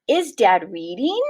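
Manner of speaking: teacher's model question, "reading" going up; rising question tune. Shown as a demonstration. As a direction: raise your voice at the end of "reading". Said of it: "our voices go up like this".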